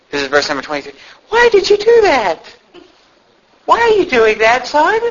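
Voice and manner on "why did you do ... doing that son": high, put-on plaintive questioning; mock-pleading parental scolding; Eli's feeble rebuke. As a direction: question a son like an ineffectual father. Acting out Eli questioning his sons.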